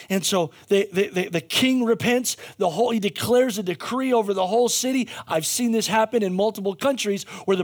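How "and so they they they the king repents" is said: ordinary delivery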